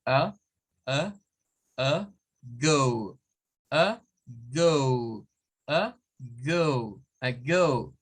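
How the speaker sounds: short "uh", pause, then long "gohw" for "a, go"; "a, go" slowly split into uh and gohw; English schwa. Shown as a demonstration. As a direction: barely open "a", drawn-out gliding "go". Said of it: "la boca no hay que abrirla mucho".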